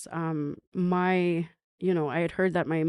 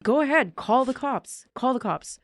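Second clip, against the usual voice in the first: defiant; 6 semitones higher, 3 dB louder than usual. Retold or imitated. Defiant reply to threatening mother.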